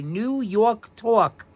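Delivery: "New York Talk" slowly in a New York accent, "Yawk Tawk"; drawn-out New York "Tawk". Shown as a demonstration. Teacher to class, demonstrating the New York accent. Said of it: "N E W Y A W K".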